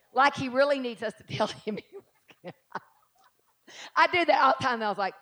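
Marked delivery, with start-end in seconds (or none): none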